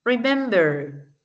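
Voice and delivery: Remember drawn out slowly, falling at the end; slow Remember, gliding downhill; descending word stress. Shown as a demonstration. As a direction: stretch Remember and let it descend. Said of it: "Va haciendo como un descenso también".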